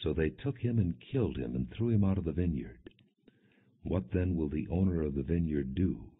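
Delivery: ordinary delivery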